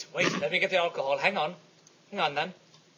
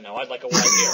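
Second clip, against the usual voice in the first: laughing while quoting; 3 semitones lower, 7 dB louder than usual. Retold or imitated. Host retelling a pub story laughs through the quote. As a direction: quote broken up by laughter, a little louder. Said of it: "the bartender asked".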